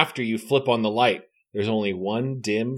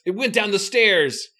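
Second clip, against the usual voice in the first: alarmed; 8 semitones higher than usual. Acted. Panicked line in spooky story.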